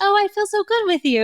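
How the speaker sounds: a mock sweet child's line; sarcastic mock quote. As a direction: sarcastically voice what no child says. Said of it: "They're like".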